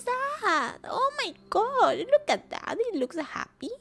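high, loud, overwhelmed wail; real sad outburst. Real, not acted. Streamer moved by how happy they look, sad wail. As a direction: burst out high-pitched, close to tears. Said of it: "why I'm so sad".

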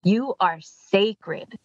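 "You are sacred" stretched very slowly; solemn affirmation. Real, not acted. Reminding loved ones and everybody, "You are sacred" said slowly and solemnly. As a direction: give "sacred" slow, deliberate weight. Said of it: "important that we remind ourselves".

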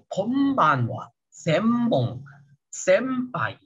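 "Konbanwa, sembong, senpai" slowly, each n as a long m; lips closed, m. Shown as a demonstration. Teacher drills the lip position, long m in "Konbanwa, sembong, senpai". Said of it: "Ketutup bibirnya ya".

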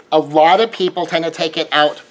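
normal talking voice

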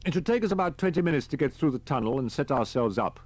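a lightly voiced, brisk army captain; captain's voice. A narrator's reading. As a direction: give the orders like a calm officer.

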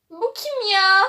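high, loud startled who-is-that; startled cry. Acted. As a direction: jump at the stranger at the door. Character startled by stranger. (yüksek ve yüksek sesle irkilmiş çığlık)

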